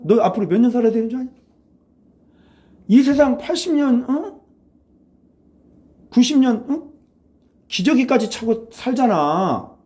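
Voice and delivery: high, insistent grilling; acted-out pleading lecture; retold scolding. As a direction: press them with exasperated, high-pitched questions. Retold challenge about living this life.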